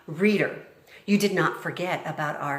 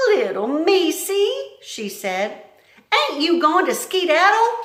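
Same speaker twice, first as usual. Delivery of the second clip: Mig coaxing; 12 semitones higher, 7 dB louder than usual. Acted. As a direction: coo at the mouse. Mig addresses mouse.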